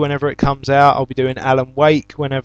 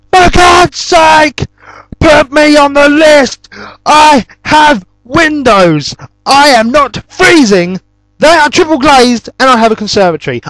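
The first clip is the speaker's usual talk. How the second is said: furious shout; 12 semitones higher, 8 dB louder than usual. Retold or imitated. Yelling at the telemarketer who keeps calling, furious. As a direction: scream it at the caller in fury. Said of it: "I was furious".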